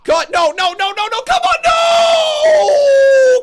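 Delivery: begging him to kick out; desperate shouting. Real, not acted. Desperate live reaction to pin.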